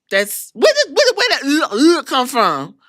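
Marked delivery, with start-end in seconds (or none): none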